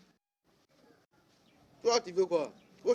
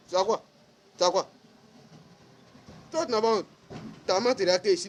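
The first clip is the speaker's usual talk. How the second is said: higher and louder, shaming him; angry scolding; 4 semitones higher, 5 dB louder, faster than usual. Real, not acted. Scolding the old man on social media, loud and angry. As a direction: scold hard, don't you have shame.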